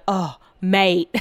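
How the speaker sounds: a light Australian accent that slips out, imitating British guys; Australian-tinged "Oh, mate" in a passing impression; accidental Australian accent. Vlogger quoting Love Island guys, lightly Australian accent. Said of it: "I sound Australian".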